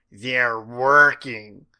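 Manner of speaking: loud, very slow growl. A narrator's reading. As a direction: drag the words out in a growl. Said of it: "she growled".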